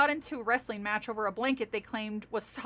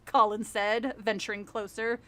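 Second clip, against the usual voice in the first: usual speaking voice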